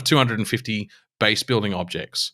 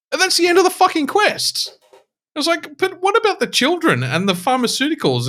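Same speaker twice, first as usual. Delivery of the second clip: much higher pitched, incredulous outburst; exasperated. Real, not acted. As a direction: throw your voice up in disbelief. Exasperated rant about broken quest.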